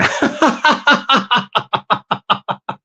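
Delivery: drawn-out, evenly pulsed laugh; rapid-fire giggling run; long staccato chuckle. Real, not acted. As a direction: crack up at your own joke in a steady rattle. Laughing at pea brain joke.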